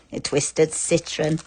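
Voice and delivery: slow, soft, sly witchy Twisted Citron; a hint of a scheming witch; Wicked Witch. Shown as a demonstration. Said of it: "you sound like you're the Wicked Witch".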